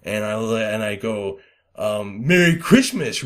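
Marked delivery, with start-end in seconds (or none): 2.3-3.2 loud, high aggressive holiday greeting; hard Merry Christmas. Retold or imitated. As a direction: spit it out like a threat. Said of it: "real hard".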